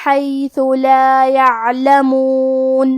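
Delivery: slow, drawn-out melodic tilawat; measured Quranic recitation. Acted. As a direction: chant each verse slowly with full tajweed. Teacher recites the verses again.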